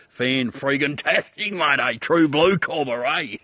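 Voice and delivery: loud, high mock-Ocker cheer; hammed-up Aussie enthusiasm. Proud Aussie joking with his mate, hammed-up enthusiasm. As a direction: play up the true blue Aussie, loud and rising.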